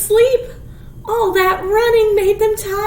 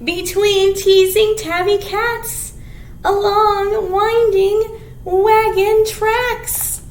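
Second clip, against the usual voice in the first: slow, louder storybook reading; reading to children; 5 dB louder, slower than usual. Acted. Teacher reading the picture book to kids. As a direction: read each phrase out with relish.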